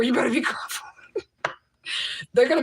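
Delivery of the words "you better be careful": high, whispered mock caution; mock warning. Warning anyone who is a grandma, playful whispered caution.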